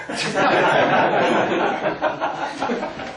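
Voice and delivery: loud, high embarrassed laughing burst. Real, not acted. A speaker laughing at his own slides, loud embarrassed laugh. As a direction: laugh it off, caught out.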